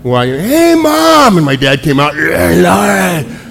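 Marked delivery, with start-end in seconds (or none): 0.2-1.4 calling out. Retold or imitated. Child calling out. 2.2-3.4 mock angry shout. Woken dad shouting.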